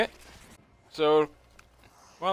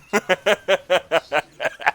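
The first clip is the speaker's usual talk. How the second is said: loud, high, rapid-fire laugh; long staccato laugh; amused laugh; 8 semitones higher, 6 dB louder than usual. Real, not acted. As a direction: laugh hard at being interrupted. Cut off while talking, laughs.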